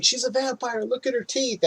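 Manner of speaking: high-pitched accusation without full volume; mock yelling. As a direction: imitate a frantic yell, held back. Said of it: "And then yelling".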